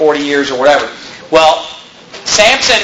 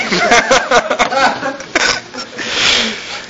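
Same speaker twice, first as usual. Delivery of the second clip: long amused chuckle, softer and higher; real giggling laugh trailing on; real laughter; 6 semitones higher, 4 dB quieter than usual. Real, not acted. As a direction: laugh at your own joke. Preacher laughs at his heifer remark.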